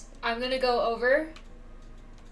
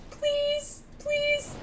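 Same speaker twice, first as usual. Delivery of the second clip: high, thin begging; real high-pitched begging; desperate pleading; 12 semitones higher, 3 dB quieter than usual. Real, not acted. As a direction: plead up high, barely holding on. Desperate pleading during a wish pull.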